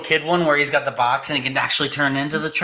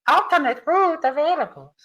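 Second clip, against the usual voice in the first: gps voice; 10 semitones higher than usual. Pretend. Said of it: "that's your G P S voice".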